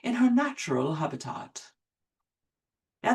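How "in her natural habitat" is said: David Attenborough. Retold or imitated. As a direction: narrate like a wildlife documentary. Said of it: "Sir David Attenborough's narration".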